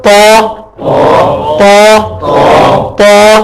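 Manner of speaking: "تا" thickened with a rounded tongue; heavy Arabic T. Shown as a demonstration. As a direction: model "تا" as a full, dark T for repeating. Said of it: "تا کو ہی تھوڑا سا موٹا کر کے". ("تا" موٹا کر کے، بغیر واو کے)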